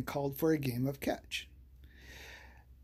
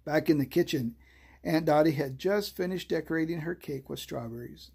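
plain reading voice

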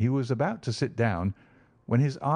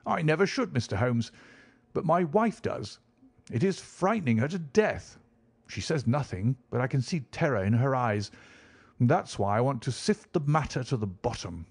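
higher, troubled squire's voice; worried country gentleman; 3 semitones higher than usual. Acted. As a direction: plead for help, a little anxious. Visitor's voice held.

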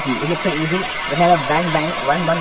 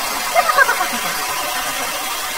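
high, wild laugh; manic laugh; 11 semitones higher, 4 dB louder than usual. Real, not acted. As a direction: laugh like a maniac fleeing. Laughing while running.

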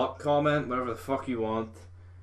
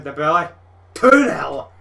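higher, louder yell at the second goal; goal celebration; 4 semitones higher, 14 dB louder than usual. Real, not acted. Gamer celebrating going two nil up, ecstatic shout. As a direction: explode with delight on the score.

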